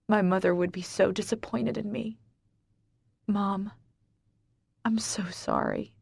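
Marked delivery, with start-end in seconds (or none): none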